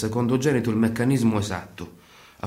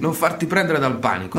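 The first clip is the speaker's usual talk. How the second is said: higher and louder, cutting him off; loud rebuke; 6 semitones higher, 4 dB louder than usual. Acted. Answering the shouting partner with a loud higher retort. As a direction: tell him off loudly to stop the panic.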